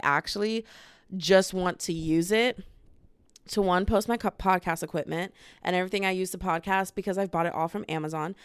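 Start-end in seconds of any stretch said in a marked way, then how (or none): none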